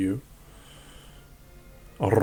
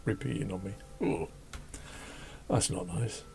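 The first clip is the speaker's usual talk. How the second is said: queasy; 4 semitones lower, 10 dB quieter than usual. Real, not acted. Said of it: "my tuna and cheese sandwich is, repeating on me".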